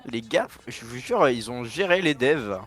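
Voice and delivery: usual speaking voice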